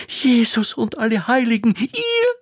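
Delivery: high squeal of disbelief; astonished cry. A narrator's reading. Old cook recognizing the princess, astonished and high. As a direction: gasp it out in shock, very high.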